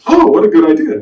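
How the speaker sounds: loud, high burst of discovery; acted eureka moment; delighted realisation. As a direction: exclaim as the idea lands. Acts out his sudden realisation.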